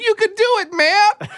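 high cheering voice, then laughing; cheering encouragement. Retold or imitated. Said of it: "grown adults saying".

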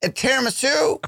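playful high order; rising high on tiramisu. Pretend. Adds dessert to the order.

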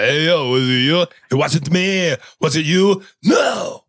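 hammed-up celebrity voices bickering; mock argument; arguing movie-star impressions. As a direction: act out a back-seat squabble in put-on voices, rising to a high "No". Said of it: "the guys are arguing in the back about who farted".

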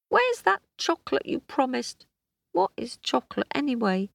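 high, quick cheeky croc voice; a greedy little crocodile demanding treats. Acted.

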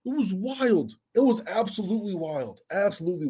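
high-pitched disbelief; excited high voice. Real, not acted. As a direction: squeal it out in amazement. Excited reaction to wild game.

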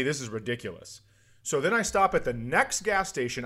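ordinary delivery

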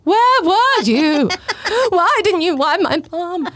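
high, drawn-out mock wail, loud at first; sobbing child. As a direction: imitate a tearful kid's accusing howl. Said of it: "he was sobbing, like, in tears".

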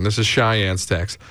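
usual speaking voice

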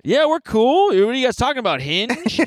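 high, eager put-on voice; try-hard cool dad. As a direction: overeager dad butting into the chat. Acted dad trying to seem cool.

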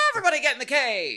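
high, very fast yell; mock summons in a high voice; playful calling out. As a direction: shout a silly rallying call. Calling everyone into imagined cave.

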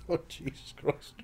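whispered, cringing aside; mortified whisper. Retold or imitated. Embarrassed teenager whispering beside his mum. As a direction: breathe it out, mortified. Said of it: "I don't know where to look".

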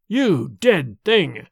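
very slow, weighted accusation; accusing. A narrator's reading. Son confronting his undead father, slowly accusing. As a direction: spell out the accusation word by word.

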